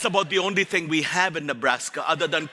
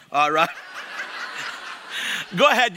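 ordinary delivery

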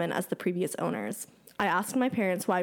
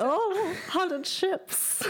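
high, giddy laughing exclamation; laughing excitedly; 11 semitones higher, much slower than usual. Real, not acted. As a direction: squeal it through a laugh. Delighted at episode topic.

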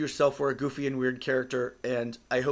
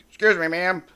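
loud, high cartoon pig; 5 semitones higher, 7 dB louder than usual. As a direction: squeal it like a polite pig. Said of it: "the pig goes between the lady's legs and says".

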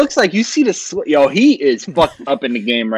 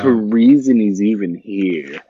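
low, drawn-out parody of a co-host; mocking echo; 7 semitones lower, slower than usual. Co-host repeating a funny-sounding line back, mocking echo. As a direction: echo it slowly, deep and drawn out. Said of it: "That sounded so funny".

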